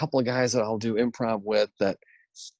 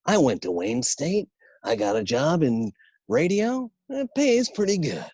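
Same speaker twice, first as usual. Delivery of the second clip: radio-ad pitchman, climbing high at the end; Casey Kasem; 5 semitones higher than usual. Acted. Announcer selling a college in a radio ad, playful impression. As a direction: imitate a radio commercial, last line lifted high. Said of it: "That's a radio commercial he used to do".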